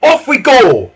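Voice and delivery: high, loud rallying call; rousing character-voice cry; chuffed send-off. A narrator's reading. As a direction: shout the start of the race. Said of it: "chuffed Freddy".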